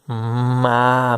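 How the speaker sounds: ma stretched out long and slow; teacher sounding ma slowly before blending; slow blending demo. Shown as a demonstration. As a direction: draw out ma slowly, letter by letter. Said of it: "falar elas rapidinho".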